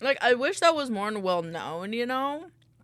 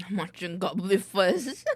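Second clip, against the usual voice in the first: laughing under breath; whispered giggle; 5 dB louder, slower than usual. Real, not acted.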